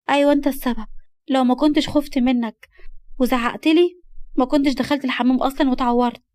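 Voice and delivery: put-on upset young girl. (بصوت بنت زعلانة بتلوم)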